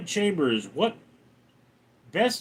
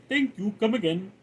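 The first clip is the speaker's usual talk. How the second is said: higher-pitched put-on Indian accent; 5 semitones higher than usual. Shown as a demonstration. Streamer doing an Indian accent on chat's request, higher-pitched. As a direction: say it in a sing-song Indian accent. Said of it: "do Indian accent".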